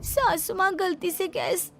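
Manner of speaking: put-on timid young wife owning up; young bride voice. Bride explains the burn to her mother-in-law, lightly voiced.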